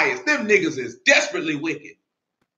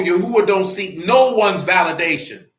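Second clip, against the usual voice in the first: usual speaking voice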